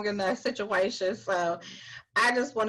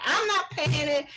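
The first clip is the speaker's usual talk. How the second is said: defiant refusal; 7 semitones higher, 3 dB louder than usual. Retold or imitated. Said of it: "She's like".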